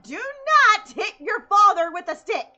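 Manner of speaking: loud, slow, high scolding; stern shout. Retold or imitated. Telling the sisters off, mock stern shout. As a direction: lay down the law, hammed up. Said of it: "I'm like".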